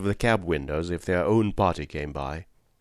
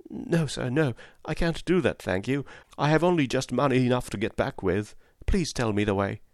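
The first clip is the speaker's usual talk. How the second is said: higher-voiced, apologetic young woman; pleading poor mother; 4 semitones higher than usual. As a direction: refuse politely, with a plea. Poor mother declines the ride.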